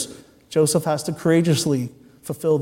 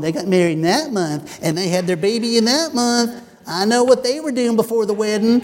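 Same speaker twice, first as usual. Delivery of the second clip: gossiping neighbor in a put-on southern accent, pitched higher; mock southern accent; 5 semitones higher than usual. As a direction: play a small-town southern gossip, higher. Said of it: "That's why I do that accent".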